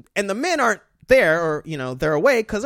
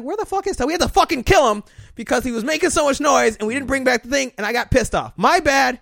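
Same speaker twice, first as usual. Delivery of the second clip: high, loud mocking women's voice; high-pitched mock scolding; mock angry women; 7 semitones higher, 5 dB louder than usual. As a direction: squeal it like nagging village women. Women voice again.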